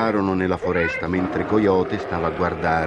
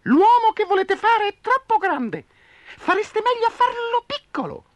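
very high tiny squeak; squeaky mouse; 5 dB louder than usual. Acted. Mouse demands the animals listen, tiny squeak. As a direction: squeak for attention. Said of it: "squittì Topo".

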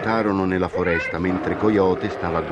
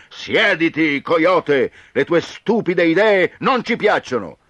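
higher, loud angry chorus; animals shouting; 12 semitones higher, 7 dB louder than usual. Acted. Animals yell at Coyote to sit, loud. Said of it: "tutti insieme gridarono".

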